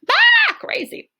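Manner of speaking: very high, slow squeal of crazy; squeaky crazy. Shown as a demonstration. Teacher acts out what ballistic means, squeaky crazy. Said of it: "Ballistic is like".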